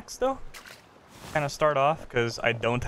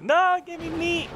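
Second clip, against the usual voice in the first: exasperated protest. Real, not acted. Player begging the game for meat, high exasperated cry. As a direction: wail at the bad loot. Protests at poor loot drop.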